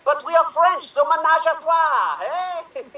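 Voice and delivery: put-on French accent. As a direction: sell a sly French leer. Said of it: "with French accents".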